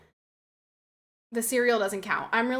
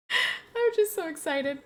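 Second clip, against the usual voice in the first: higher, slow and drawn-out excitement; excited; 6 semitones higher, slower than usual. Real, not acted. Expectant mother overcome after a laugh, tender excitement.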